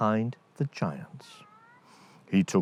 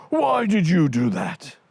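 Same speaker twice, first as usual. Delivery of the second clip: louder, higher giant's accusation; angry giant; 7 semitones higher, 5 dB louder than usual. Acted. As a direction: blame the other giant hotly. Second giant accuses the first.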